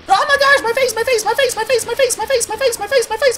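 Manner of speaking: high, loud wailing repeated; mock panic. Streamer imitating himself getting splatted, shrieking. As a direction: shriek it over and over in hammed-up panic. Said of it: "now I'm just like".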